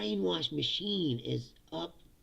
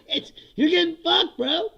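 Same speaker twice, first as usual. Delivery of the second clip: laughing; 12 semitones higher, 9 dB louder than usual. Real, not acted. Laughing in exasperation.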